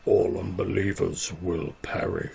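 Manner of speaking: low, booming sea creature voice; deep echoing underworld god. Acted. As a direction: boom it out like an ancient god. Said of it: "and in a deep voice says".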